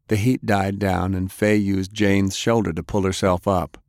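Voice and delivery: usual speaking voice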